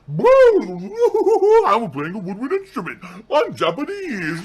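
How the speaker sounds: very high, loud, drawn-out whoop; hammed-up yell. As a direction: belt it out high and silly. Hammed-up outburst after mock child voice.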